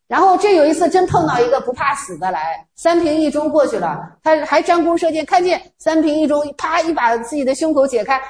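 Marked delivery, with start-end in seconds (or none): none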